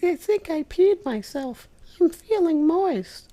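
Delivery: put-on high falsetto girl voice. Girl character confessing to professor, high falsetto. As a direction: squeak it out like a giddy girl.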